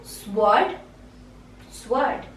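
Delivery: "sword" said swurd, w sounded; rising, questioning "sword" with a clear w; mispronunciation. Shown as a demonstration. Teacher quizzing learners, "sword" wrongly as swurd.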